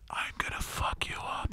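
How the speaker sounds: soft menacing whisper; whispered threat. Retold or imitated. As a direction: hiss the threat under your breath. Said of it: "whispering that to me".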